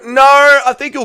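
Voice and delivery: loud, high, drawn-out no; emphatic refusal. Real, not acted. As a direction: cry out in firm denial. Rejects a viewer's prediction.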